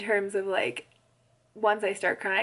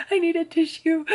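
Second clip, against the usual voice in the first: higher-pitched imitation of crying; mock weepy voice, pitched up; mock tearful; 7 semitones higher, 4 dB louder than usual. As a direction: retell a tearful plea, half laughing. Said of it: "I was crying so hard".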